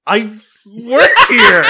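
slow, loud, outraged retort; indignant shout. Pretend. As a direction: bellow it like an offended employee. Mock indignant boss outburst.

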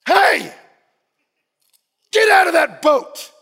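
loud, high harsh shout; angry tone. Retold or imitated. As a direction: bark it like a harsh, condemning voice. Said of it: "with an angry tone".

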